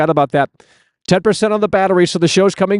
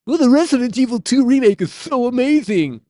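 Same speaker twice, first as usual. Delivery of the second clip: high, gushing, sneering parody of a fan; mocking fan voice; 8 semitones higher than usual. Mocking the Resident Evil fans, a high gushing fan voice. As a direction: make the fan sound dim and starry-eyed.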